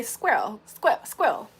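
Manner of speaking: squirrel as "skwell", imitating a UK accent. Showing friends a certain UK accent, imitated. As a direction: mimic UK squirrel, short "skwell". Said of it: "with a certain U K accent".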